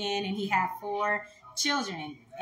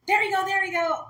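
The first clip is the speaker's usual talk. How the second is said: excited calling out; 10 semitones higher, 5 dB louder than usual. Retold or imitated. Brother spotting dad in crowd.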